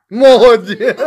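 loud, high praise breaking into laughter; delighted high laugh after the word. Real, not acted. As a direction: praise the dog, then crack up.

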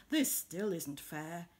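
a touch of concern, drawn out. Acted. Bear notices Beetle left out.

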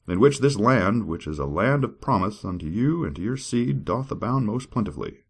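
grand, high dramatic reading; scripture reading. Acted. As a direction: declaim it like a preacher. Reads scripture.

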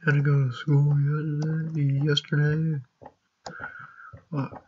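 groggy just-woke-up mumble; tired and sleepy. Real, not acted. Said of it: "Freaking tired".

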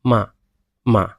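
মা cut short with a quick fall; fourth tone demo. Shown as a demonstration. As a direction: snap মা off fast, dropping. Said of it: "সাউন্ডটা দ্রুত শেষ করে দিতে হবে".

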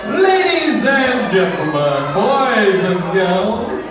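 slow grand showman announcement; announcer voice. Acted. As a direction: stretch it out like a compere. Compere addressing the audience.

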